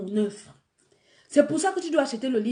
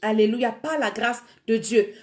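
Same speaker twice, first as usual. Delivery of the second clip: ordinary delivery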